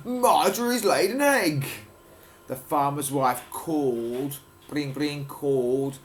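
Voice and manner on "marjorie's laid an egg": excited. Acted. Farmer's excited exclamation continues.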